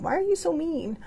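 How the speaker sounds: high, whiny playground taunt; childish whine. Pretend. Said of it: "I sound like I'm on the playground again".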